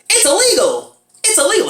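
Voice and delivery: high, loud, repeated cry of protest; mock outraged cousin. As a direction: yelp it in hammed-up alarm. Imitating cousin repeating his objection.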